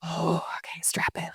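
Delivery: whispered, like a campfire tale opening; spooky whisper. Acted. Asked for a trippy story, whispering the start. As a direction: hush it down to a secret whisper. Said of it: "And tell us a trippy story".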